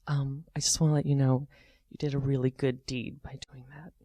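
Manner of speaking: soft, low imitated cashier; gentle aside. Cashier privately praising the teller, imitated soft low voice. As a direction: say it softly, confidentially.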